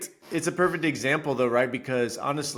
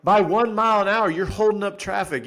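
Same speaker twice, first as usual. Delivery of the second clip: high, loud exasperated protest; retold heated outburst; indignant protest; 9 semitones higher, 4 dB louder than usual. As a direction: argue your case to the cop. Retold arguing with cop.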